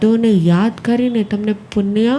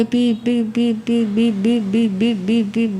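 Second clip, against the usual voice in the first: imitated car alarm beeps; slower than usual.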